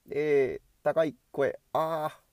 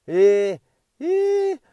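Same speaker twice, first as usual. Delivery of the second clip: much higher and louder; high voice test; 12 semitones higher, 7 dB louder, much slower than usual. Shown as a demonstration. Vlogger testing a much higher voice. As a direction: slide up into a high voice. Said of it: "高い声の人って".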